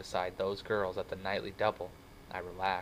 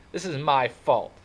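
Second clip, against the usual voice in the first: raised, slow, miserable self-blame; 6 semitones higher, 10 dB louder than usual. A narrator's reading. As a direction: blame yourself loudly and wretchedly. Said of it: "said in a miserable voice".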